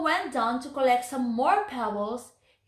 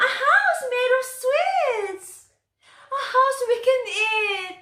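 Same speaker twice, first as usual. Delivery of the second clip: much higher and louder, thrilled cry; delighted; 12 semitones higher, 5 dB louder than usual. Acted. Hungry children discovering the gingerbread house, delighted. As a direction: shout it like children spotting sweets. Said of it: "they said happily".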